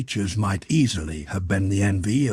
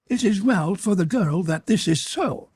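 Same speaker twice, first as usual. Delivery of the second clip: high-pitched creature voice, easing slightly; imperious creature; 11 semitones higher than usual. A narrator's reading. Creature passes judgement on the girl, relieved but menacing. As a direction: pronounce it in a high, otherworldly voice.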